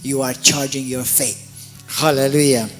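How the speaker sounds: usual speaking voice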